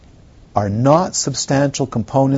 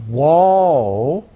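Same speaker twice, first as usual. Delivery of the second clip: 1 demo whoa; 2 semitones higher than usual. Shown as a demonstration. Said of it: "teacher demonstrates the whoa".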